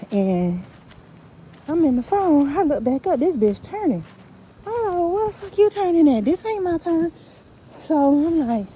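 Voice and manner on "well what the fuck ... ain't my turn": retold protest. Retold protest at wrong turn.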